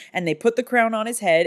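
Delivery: ordinary talk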